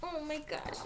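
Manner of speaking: ordinary delivery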